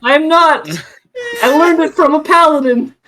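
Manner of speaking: high-pitched defensive protest; indignant protest. Acted. Accused spell-caster defending where it was learned, protesting.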